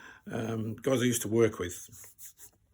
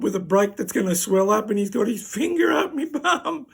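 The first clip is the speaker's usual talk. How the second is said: hammed-up alarm climbing much higher and louder; comic panic; 8 semitones higher, 6 dB louder than usual. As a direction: let the mock panic rise to a high, loud peak. Panicked thought during exam, acted.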